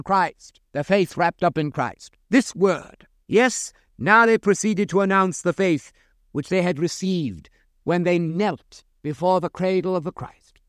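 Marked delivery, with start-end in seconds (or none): none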